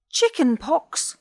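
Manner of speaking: high, slow alarmed squawk; panicked penguin repeating the diagnosis; alarmed cry. A narrator's reading. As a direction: penguin crying out in shock. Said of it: "cried the penguin".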